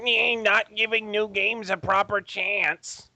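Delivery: high, slow, mocking whine; mock whining critics. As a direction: mimic complainers in a silly drawn-out voice. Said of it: "to the gibbering, shit-flinging fucking simians".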